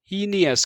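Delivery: हीनियस as HEE-ni-yas, three syllables; common misreading. Shown as a demonstration. Teacher showing students' mistake, हीनियस with HEE and a sounded i. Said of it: "अब इसे कई बच्चे ही पढ़ेंगे".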